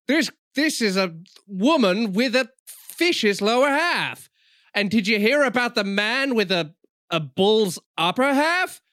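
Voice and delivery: high, loud, drawn-out incredulous delivery; slow, loud, hammed-up wonder; mock amazement. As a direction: sell it like a ridiculous marvel. Mocking their limited creativity.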